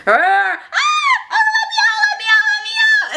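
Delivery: very high, panicked mock screams; screaming. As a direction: shriek to be freed, hammed up. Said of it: "even if I scream".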